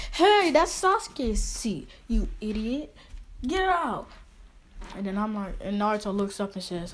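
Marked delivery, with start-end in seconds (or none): 0.1-4.1 high, drawn-out mock screech; hammed-up shrieking girl, loud and high; screaming like banshees. Two girls scolding Naruto over Sasuke's seat, mock screaming. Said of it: "started screaming like banshees".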